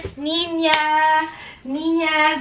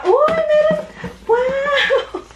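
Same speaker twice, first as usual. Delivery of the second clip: delighted surprise; 11 semitones higher, much slower than usual. Real, not acted. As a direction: gasp out with glee. Delight finding photos. (masayang pagkagulat)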